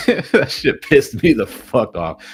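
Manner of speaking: slow, laughing complaint; laughing gripe. Real, not acted. Trader laughing about the red candle. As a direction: drag it out through a laugh.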